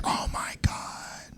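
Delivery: hushed, slow new-parent wonder; awed whisper. Acted. New parent whispering over the crying baby, awed whisper. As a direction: whisper in amazement, drawn out.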